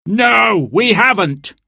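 high, heckling denial; indignant call from the floor. A narrator's reading. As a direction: call out in protest from the crowd. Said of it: "called another".

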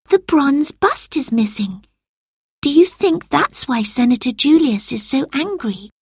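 put-on high, worried girl; a curious girl asking anxiously; young girl voice. A narrator's reading. Lucy voiced as child.

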